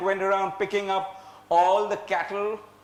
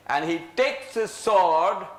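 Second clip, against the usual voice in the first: ordinary delivery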